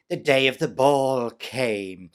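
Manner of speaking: ordinary delivery, nothing marked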